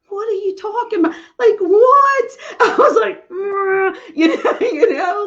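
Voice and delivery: high, loud tearful cry with laughs; overcome, tearful and laughing; choked up. Real, not acted. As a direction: voice leaps up, half crying, half laughing. Overcome while retelling Jesus's answer.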